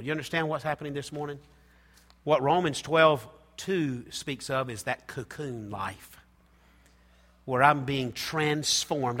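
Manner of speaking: ordinary talk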